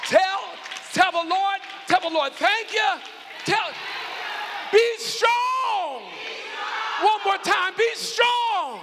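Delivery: loud, high shouted call and response; preaching climax. Acted. Pastor leading the final shouts of be strong. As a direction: lift the whole church to a peak.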